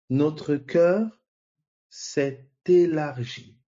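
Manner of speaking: « élargi » stretched out very slowly after a rising « cœur »; répétition lente et appuyée, « élargi » très étiré; slow emphatic repeat. Shown as a demonstration. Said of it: "Vous voyez?". (redis-le lentement, en étirant « élargi »)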